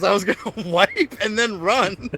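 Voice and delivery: high, loud and slow through laughter; drawn-out quote broken by a laugh; laughing retelling. Retold or imitated. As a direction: crack up while quoting dad. Retelling dad's line while cracking up.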